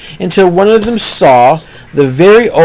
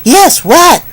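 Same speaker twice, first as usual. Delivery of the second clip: very high, louder pig squeak; high squeaky piglet voice; 12 semitones higher, 5 dB louder than usual. Acted. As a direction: pipe up like a tiny excited pig. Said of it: "said another".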